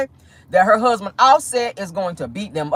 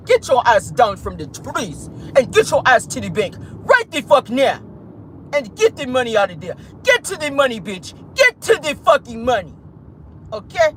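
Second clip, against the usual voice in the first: hammed-up African accent, punching each order; 2 semitones higher than usual. Host replaying investigators' orders, mock African accent. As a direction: give commands in a put-on African accent. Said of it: "we got an African accent when we need to".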